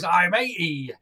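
put-on old pirate saying I'm eighty in West Country burr; light West Country pirate voice; pirate accent. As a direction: deliver the pun as a Devon pirate. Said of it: "He goes".

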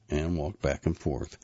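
ordinary delivery, nothing marked

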